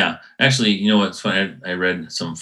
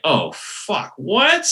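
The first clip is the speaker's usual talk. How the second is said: shocked; 7 semitones higher, much slower than usual. Retold or imitated. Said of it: "And I was like".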